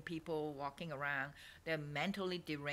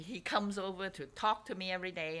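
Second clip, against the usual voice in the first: ordinary talk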